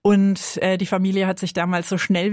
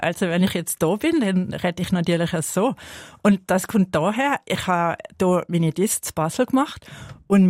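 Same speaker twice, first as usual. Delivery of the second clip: Swiss German dialect. Shown as a demonstration. Interviewee showing how she talks here, Swiss German dialect. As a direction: switch into Basel-style Swiss German. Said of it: "dann rede ich natürlich auch so".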